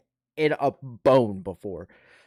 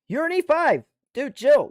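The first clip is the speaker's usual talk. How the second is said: exasperated plea pitched way up; high-pitched protest; 13 semitones higher, 4 dB louder than usual. Retold or imitated. Soldier pushing back at an E five on the phone, high-pitched protest.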